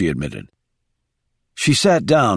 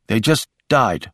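ordinary delivery, nothing marked

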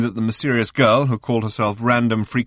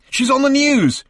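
high, loud shout from the next room; 6 dB louder than usual. A narrator's reading. Arthur calling from the next room to Ford, shouting. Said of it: "shouted Arthur from the next room".

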